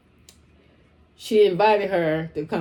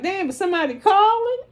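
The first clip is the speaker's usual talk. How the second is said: surprised exclaim; 13 semitones higher, 7 dB louder than usual. Retold or imitated. Surprised reaction quote.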